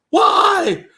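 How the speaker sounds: shrill disbelieving why; incredulous high why. Real, not acted. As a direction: squeal it in disbelief. Outraged at Patriots getting Moss.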